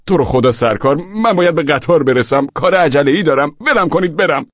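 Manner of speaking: loud, high urgent begging; pleading. A narrator's reading. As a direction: beg the policeman to let you go. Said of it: "به پلیس التماس کردم".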